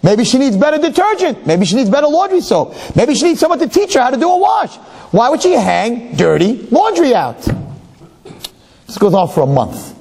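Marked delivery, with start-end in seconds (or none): 0.0-7.4 put-on high, indignant young wife; scandalised wife. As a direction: pile up the complaints about the neighbour. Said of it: "So she says".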